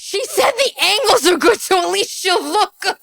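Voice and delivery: can't believe she said it; stunned outrage; incredulous. Real, not acted. Outraged repeating her words.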